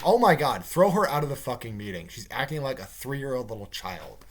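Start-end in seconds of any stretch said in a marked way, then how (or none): 0.0-2.1 acted exasperation; exasperated outburst. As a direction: blurt it out, fed up with the pest. Exasperated reaction to the interrupting.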